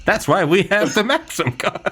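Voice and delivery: high, proud newsreel voice; old-timey voice. Acted. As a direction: boast it like an imperial announcer. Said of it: "Old-timey voice".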